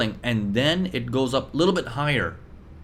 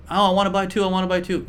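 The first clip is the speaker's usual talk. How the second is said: greedy eager buyer; 7 semitones higher, much faster than usual. Retold or imitated. Teacher voicing greedy crowd begging to buy, pitched-up eager voice.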